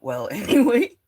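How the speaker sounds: loud real laugh that breaks into the word. Real, not acted. Laughs walking up the hill.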